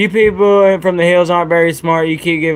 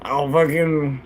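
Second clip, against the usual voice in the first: normal talking voice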